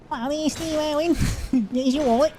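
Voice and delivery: very high, hammed-up Australian ned; Aussie ned accent. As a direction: do an Aussie thug in a squeaky high pitch. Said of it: "They talk like that with an Aussie accent".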